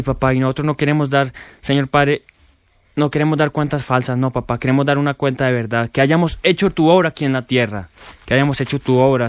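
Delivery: ordinary talk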